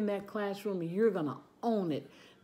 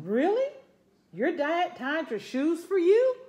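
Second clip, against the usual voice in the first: put-on high, loud, amazed little girl; amazed kid voice; 9 semitones higher, 6 dB louder than usual. Little girl asking classmates about shoes, high amazed. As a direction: voice a kindergartner, astonished.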